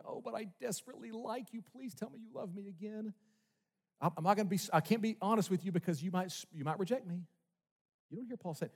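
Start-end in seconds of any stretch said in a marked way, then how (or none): 0.1-7.2 mock a needy people-pleaser, whining for love; needy pleading. Paul imagined begging his church for approval, needy and pleading. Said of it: "You don't hear Paul in this letter going".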